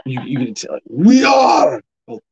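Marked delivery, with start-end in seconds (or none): 0.9-1.8 loud, high mock-scary Arabic accent. Host showing how to sound scary, mock Arabic accent. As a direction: belt it out in a hammed-up Arabic accent. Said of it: "make it sound really scary too".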